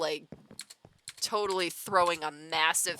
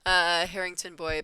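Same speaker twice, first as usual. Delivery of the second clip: ordinary talk, nothing marked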